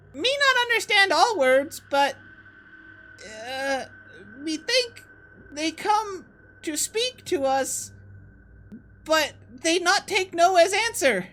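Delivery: very high, slow broken goblin speech. Acted. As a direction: squeak it out haltingly like a small goblin. GM voices goblin.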